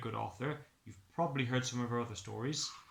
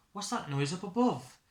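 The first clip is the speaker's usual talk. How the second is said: higher surprised question; startled Stickman; 4 semitones higher, 4 dB louder than usual. Acted. Stickman hears a noise up the chimney, surprised. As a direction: wake up puzzled.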